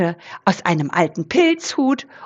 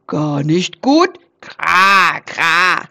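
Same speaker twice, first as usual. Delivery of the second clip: slow, lower raven croak; 3 semitones lower, much slower than usual. Acted. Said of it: "krächzte es von oben". (krächzende Rabenstimme)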